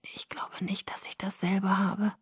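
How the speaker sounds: very soft, weak whisper; almost inaudible. A narrator's reading. Sick boy doubts he has the same illness, almost inaudible. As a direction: whisper so it can hardly be heard. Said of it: "Seine Antwort war fast unhörbar".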